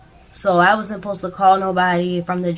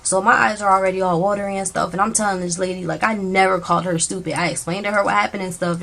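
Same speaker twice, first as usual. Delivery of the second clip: usual speaking voice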